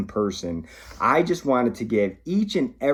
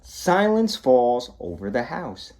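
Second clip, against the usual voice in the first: ordinary delivery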